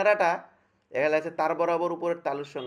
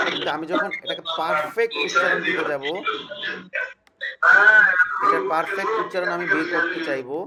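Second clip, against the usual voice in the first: emphatic teaching; 4 semitones higher, 9 dB louder than usual. Real, not acted. Teacher stresses perfect pronunciation.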